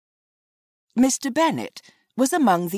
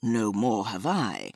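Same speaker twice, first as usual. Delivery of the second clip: low, slow, softer dry remark; dry father; 6 semitones lower, 4 dB quieter, slower than usual. A narrator's reading. Husband answering his wife, low and slow. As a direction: drop it flat like a weary husband.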